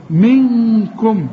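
منكم very slow with held ghunna; slow nasalized. Shown as a demonstration. Teacher demonstrating ikhfa to student, very slow. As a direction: hum the nun of منكم long. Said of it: "من حروف الإخفاء".

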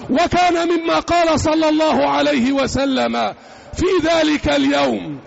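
ordinary talk, nothing marked

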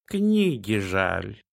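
put-on slow purring cat accent. A narrator's reading. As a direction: drawl it like a talking cat. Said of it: "новый голос с кошачьим акцентом".

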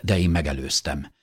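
ordinary delivery

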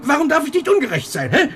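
the question bursts out of him; agitated outburst. Acted. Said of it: "Du bist außer dir".

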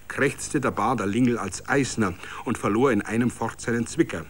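ordinary delivery

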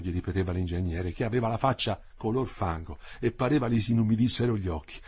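usual speaking voice

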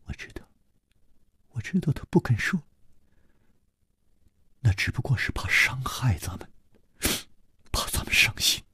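near-whispered words holding back tears; soft whisper, trying to stay composed; calm hushed whisper. Acted. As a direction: whisper gently to spare their hurt. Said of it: "安然说".

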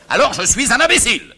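high, indignant retort; affronted outburst. Acted. Father takes offence.

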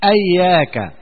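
"إياك" said ayyāka with a doubled ya; fatha and shadda. Shown as a demonstration. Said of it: "بفتح الهمزة والتشديد". ("إياك" بفتح الهمزة والتشديد)

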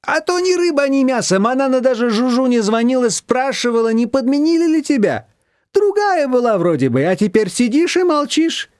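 put-on high, lively older woman; Shushana's voice. A narrator's reading. Shushana teasing Nina, high and lively. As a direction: tease her warmly.

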